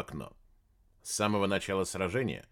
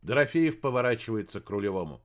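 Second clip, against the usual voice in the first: ordinary delivery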